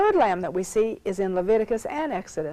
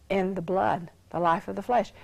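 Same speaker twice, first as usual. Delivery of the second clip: ordinary talk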